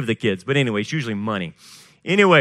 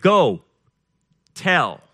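"Go," punched louder, a pause, then "tell"; emphasized verbs; 3 semitones higher, 5 dB louder than usual. Shown as a demonstration. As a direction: set "Go" and "tell" apart as two commands. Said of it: "You see the, the, the verbs there?".

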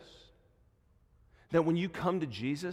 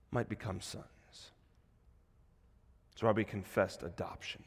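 low, soft, solemn sermon voice; grave, quiet pulpit delivery; hushed preaching; 5 semitones lower, 5 dB quieter than usual. Real, not acted. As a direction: drop right down after the climax and let it sink in. Hushed preaching after the climax.

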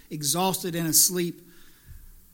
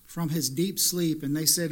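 ordinary talk, nothing marked

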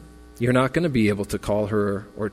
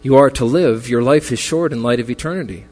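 louder, building preacher's exhortation; rally the mourners toward eternity's hope; preaching; 4 dB louder than usual. Acted. Pastor urging mourners at Patty's memorial, louder preaching.

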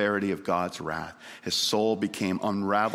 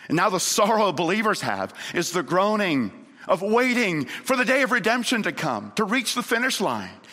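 high, urgent proclamation; impassioned pulpit exhortation; fervent preaching; 10 semitones higher, 3 dB louder than usual. Real, not acted. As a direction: preach it with a raised, driving voice. Preaches at full force.

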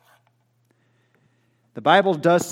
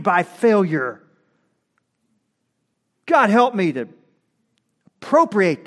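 raised, ringing declaration rising in pitch; full-force preaching; 8 semitones higher, 6 dB louder than usual. Real, not acted. Preacher proclaiming freedom to the congregation, impassioned and loud. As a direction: preach it loud and high, hitting each phrase.

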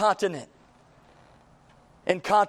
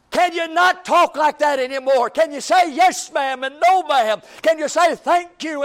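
exasperated parent, higher and louder, demanding; scolding parent; 7 semitones higher, 6 dB louder than usual. Acted. Parent voiced in the sermon scolding a child, exasperated shout. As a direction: scold a child who won't say yes ma'am.

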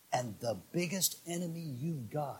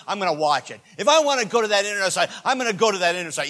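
loud, high first halves, dropping on each comeback; mock defiance; 6 semitones higher, 10 dB louder, much faster than usual. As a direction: rattle it off like a willful child.

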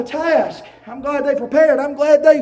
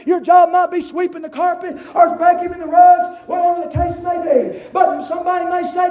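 loud, rising sermon cadence; full-force preaching; 4 semitones higher, 4 dB louder than usual. Acted. Pastor preaching to Mount Paran, full force. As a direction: preach it out hard to the congregation.

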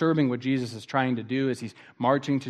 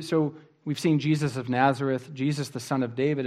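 ordinary delivery

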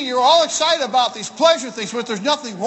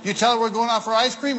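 normal talking voice

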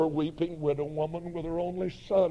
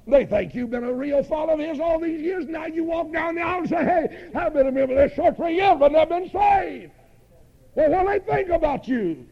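high, loud, rushing challenge; rapid, shouted revivalist taunt; impassioned preaching; 10 semitones higher, 8 dB louder, faster than usual. Acted. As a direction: fire it out from the pulpit. Preacher voices doubts at full force.

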